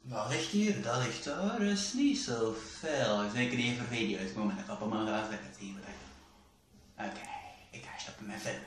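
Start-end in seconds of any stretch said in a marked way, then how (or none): none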